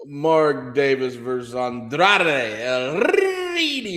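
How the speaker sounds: "Ritmo" with a trilled Spanish r; rolled r. Shown as a demonstration. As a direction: roll the r in "Ritmo", slow and drawn out. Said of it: "You like how I rolled my R there?".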